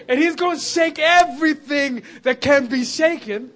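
loud, high, climactic preaching; full-force preaching. Acted. Preacher proclaiming the Spirit already poured out, loud full-force preaching. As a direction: drive it home to the back row.

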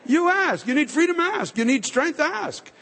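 preacher building the crowd to a peak; climbing exhortation. Real, not acted.

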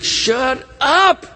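high, punched command; shouted rebuke. Acted. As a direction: yell it as two separate words. Crowd silencing the crying beggar.

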